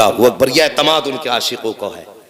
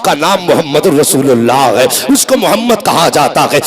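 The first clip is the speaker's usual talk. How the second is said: driving, emphatic sermon storytelling; fervent Urdu preacher's delivery; impassioned preaching; 2 semitones higher, 4 dB louder than usual. A narrator's reading. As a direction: preach the tale in urgent, unbroken waves. Preaches a story at full force.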